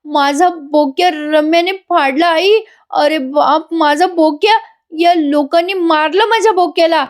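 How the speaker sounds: high, drawn-out pretend wail; mock bawling. Wife wailing to mother-in-law that toy was torn, mock bawling. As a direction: wail it like a hammed-up cry. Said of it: "मोठ्याने काव्या रडते बरं का".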